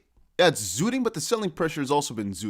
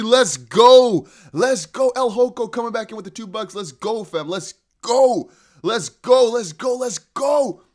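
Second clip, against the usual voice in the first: chanted, excited calls; rally the chat like a crowd; hype cheering; 4 semitones higher, 6 dB louder, slower than usual. Real, not acted. Cheering as price pumps.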